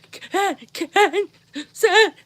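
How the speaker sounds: high, wailing words broken by crying; a little girl bawling out the betrayal; sobbing child. Retold or imitated. Little girl sobs to her dad about what her brother said.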